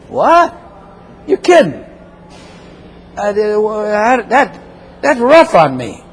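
high, stunned "What?" then stammering; shocked sinner. Acted. Preacher voicing a sinner stunned by God's verdict, high and stammering. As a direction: play the sinner floored by the news. Sinner hearing he must die.

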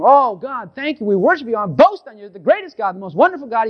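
high, lifted voice of praise; thankful praise. Retold or imitated. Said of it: "Then you thank Him".

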